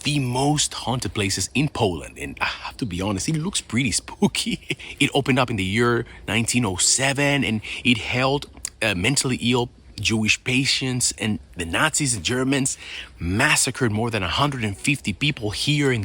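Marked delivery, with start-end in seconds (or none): none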